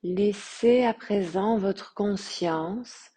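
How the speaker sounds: ordinary delivery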